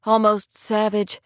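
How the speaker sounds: usual speaking voice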